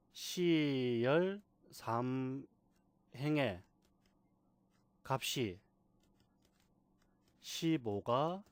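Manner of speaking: very slow, word-by-word read-out; demonstrated reading. Shown as a demonstration. Teacher shows beginners how to read a cell, slow demonstration. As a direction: stretch and separate each part for learners. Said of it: "이렇게 읽는 겁니다".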